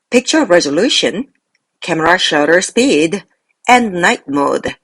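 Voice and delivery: slow, measured list, "speed" lifted, "night mode" closing it; parallel list intonation. Shown as a demonstration. Teacher shows learners how it should be read, slow list intonation. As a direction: pause after "resolution" and "speed", then finish on "and night mode". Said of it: "이렇게 읽어주면 됐었었습니다".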